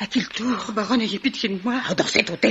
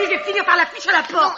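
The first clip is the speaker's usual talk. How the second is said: normal talking voice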